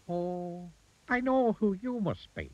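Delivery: high-pitched little goblin. A narrator's reading. Goblin to rude Mister Uppity, high goblin voice. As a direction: speak well above your own pitch, as a goblin.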